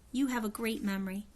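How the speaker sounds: usual speaking voice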